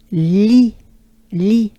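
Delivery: "li" as bright "lee" with wide smile; smiling French li. Shown as a demonstration. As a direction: smile widely through "li". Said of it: "two big smiles".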